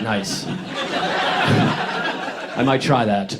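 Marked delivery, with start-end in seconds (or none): 0.0-0.4 a quiet, dry nice; muted nice. Retold or imitated. Dry nice to a friend's odd advice. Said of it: "I was like". 0.5-2.5 unvoiced high wheezing laugh; breathy laugh. Real, not acted. Audience hears comic wheezing laugh at the advice.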